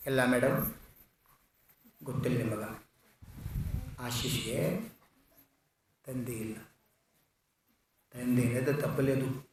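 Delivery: very soft, low, halting; grave and hushed. A narrator's reading. Principal tells teacher the boy is fatherless, sorrowful hush. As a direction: break it gently, with long pauses.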